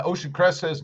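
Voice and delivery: ordinary talk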